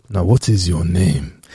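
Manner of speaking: low, slow, measured repeat of the question; insistent questioner. Acted. Man in Niger presses the visitor for a name, low and slow. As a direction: ask it deep and drawn out, insisting. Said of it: "and I'll be like".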